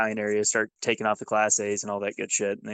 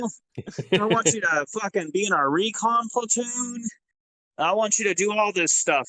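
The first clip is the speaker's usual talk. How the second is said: pitched-up, gung-ho officer quote; eager colonel; 8 semitones higher than usual. Retold or imitated. Colonel urging him toward Ranger school, pitched-up and eager.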